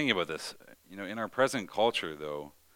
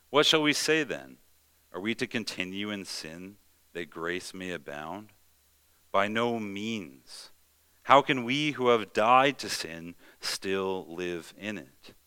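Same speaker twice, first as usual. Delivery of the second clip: forceful preached reading of Paul, slow on the questions; scripture reading; 3 semitones higher, 6 dB louder than usual. Acted. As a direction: proclaim the verses with weight. Reads Romans aloud.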